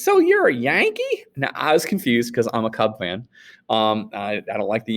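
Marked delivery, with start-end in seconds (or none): 0.0-1.3 much higher, slow drawled question; mock Southern clerk. Teacher mimicking the clerk calling him a Yankee, high and slow. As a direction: squeak it up like a suspicious local.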